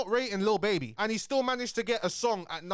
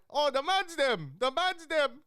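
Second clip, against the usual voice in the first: imitating Americans; 10 semitones higher, slower than usual. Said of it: "it's like".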